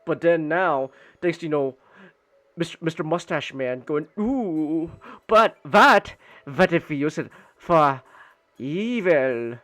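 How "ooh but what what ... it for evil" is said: hammy evil plotting voice; a touch of cartoon villain scheming. Voicing Hitler's scheming idea.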